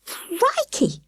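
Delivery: high, stammered exclamation; boy startled by the ghostly shape. A narrator's reading. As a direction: blurt it out in fright. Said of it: "stuttered Sam".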